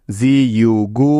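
জি ইউ গু spelled out slowly letter by letter; teacher slowly spelling জি ইউ গু. Shown as a demonstration. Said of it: "এই শব্দটিতে কি কি syllable পেলাম".

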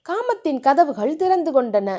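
ordinary delivery

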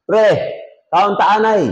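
imitated Cebuano speech; Cebuano accent. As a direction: call out like a friend from Cebu, in Cebuano accent. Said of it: "Punta ka doon sa Cebu".

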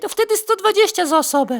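a mock ticket clerk answering; put-on cashier voice. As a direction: pipe it up like a cheeky cashier. Ticket seller's put-on reply.

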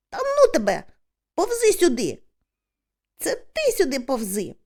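high, loud exasperated calls; quarrelling across a distance; bickering shouts. A narrator's reading. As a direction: holler back in a squabble.

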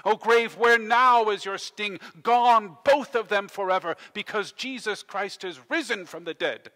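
building to a loud, ringing climax; exultant full-voiced sermon finale; triumphant preaching. Real, not acted. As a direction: proclaim victory with mounting force.

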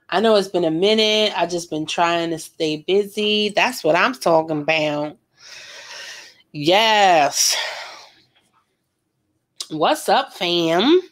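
ordinary talk

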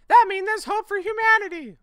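very high, loud, excited squeal; excited squeaky dude. Acted. Friend realizing a girl exists, high squeal. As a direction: shout it like a squeaky teen. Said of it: "This guy has great dialogue".

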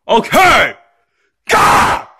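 exasperated yell into a scream. Acted. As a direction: give in with a high tantrum shout. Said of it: "stop screaming".

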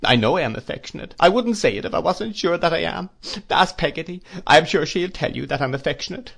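high-pitched, distressed pleading; piteous imploring. A narrator's reading. Mother insists she is affectionate, imploring piteously. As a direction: insist desperately, begging to be believed. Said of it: "implored my mother very piteously".

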